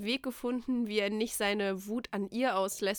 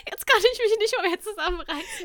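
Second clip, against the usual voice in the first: laughing; 10 semitones higher, 9 dB louder than usual. Real, not acted. Narrator breaks into laughter.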